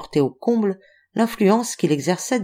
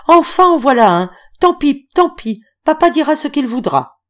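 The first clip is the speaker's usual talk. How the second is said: much higher and louder, gleeful cry; excited shout; 8 semitones higher, 6 dB louder than usual. A narrator's reading. Girl hanging on Octave's neck shouts with joy. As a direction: shout it out, clinging to his neck. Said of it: "en criant".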